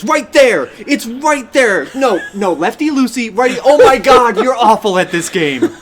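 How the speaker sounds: yelling backseat gamer chat; raging gamer. Retold or imitated. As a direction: shout like an angry stream chat. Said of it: "entire audience goes".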